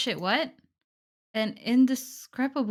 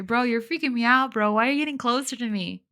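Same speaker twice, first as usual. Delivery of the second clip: louder, higher, fast alarmed pleading; genuinely spooked, rushing the words; freaked out; 4 semitones higher, 5 dB louder than usual. Real, not acted. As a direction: back away from him in real panic. Real reaction to a creepy character approaching.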